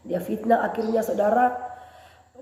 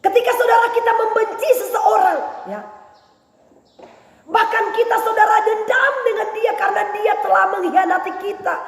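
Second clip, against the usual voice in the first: high, loud sermon shout; preaching at full force; 11 semitones higher, 8 dB louder than usual. Acted. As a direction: drive the hurt home to the church. Preacher shouts about hurt.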